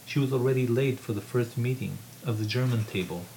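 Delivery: measured reading voice, lightly voicing the characters; story narration. A narrator's reading. Author reading his novel aloud to a room, measured narration. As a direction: read the novel to an audience.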